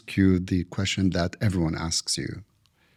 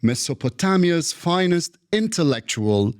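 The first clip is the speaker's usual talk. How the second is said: formal introduction; 5 semitones higher than usual. Acted. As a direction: present the guest like a gala host. Host reads formal guest introduction.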